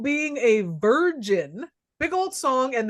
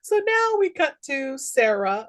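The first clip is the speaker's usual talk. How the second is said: ordinary delivery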